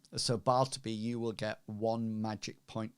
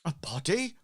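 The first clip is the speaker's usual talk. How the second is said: surprised question, pitched up; startled innkeeper; 7 semitones higher than usual. Acted. Innkeeper hearing of a body from Hazra, startled. Game master voices shocked innkeeper.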